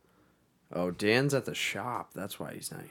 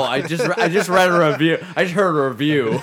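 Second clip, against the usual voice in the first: laughing; 6 semitones higher, 11 dB louder than usual. Real, not acted. Laughing at his own slip.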